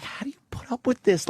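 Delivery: mock question.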